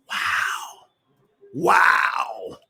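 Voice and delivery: very soft, drawn-out wow; hushed wow. Real, not acted. Preacher reacting to the verse just read, hushed wow. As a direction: let it out under the breath.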